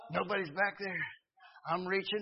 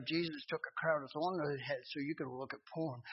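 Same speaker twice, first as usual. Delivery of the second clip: still small voice; 3 semitones lower, 6 dB quieter than usual. Retold or imitated. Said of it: "Let God talk to you in the same way".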